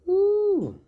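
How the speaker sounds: shrill high loud woo. Real, not acted. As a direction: exclaim in disbelief. Reacting to riot count.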